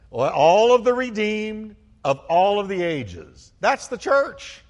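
high, loud, drawn-out exclamation; slow, soaring pulpit cry; real exultant preaching. Real, not acted. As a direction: proclaim it in rising triumph. Preacher exclaims to his congregation.

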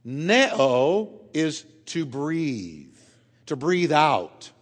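Neo and breathe drawn out very slowly; preacher slowing to explain the Greek; very slow explanation. Shown as a demonstration. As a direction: lay each word out slowly. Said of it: "let's break that word up".

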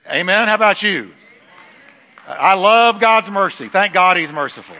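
loud, high preaching cry. Acted. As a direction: preach it out with full force. Preaching at full force.

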